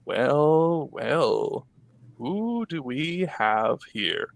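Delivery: slow, sly mouth-creature voice. A narrator's reading. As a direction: drawl it out slyly. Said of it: "it speaks in an odd, sly tone".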